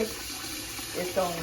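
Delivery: "gonna" stretched very slow; drawn out. Real, not acted. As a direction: drag the word out for suspense. Praising well-seasoned food.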